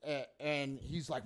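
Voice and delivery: usual speaking voice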